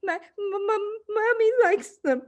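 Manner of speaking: put-on high, trembling, stammering child; terrified stutter. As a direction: stammer it out, paralysed with fright. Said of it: "managed to stutter".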